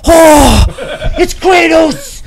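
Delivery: put-on high, loud child voices, breathless; excited kids. Little kids mob the hero they recognize, excited. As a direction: gasp as if meeting a hero.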